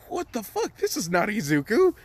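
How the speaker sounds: hammed-up high disbelief; mock shock. Whole class reacts in disbelief.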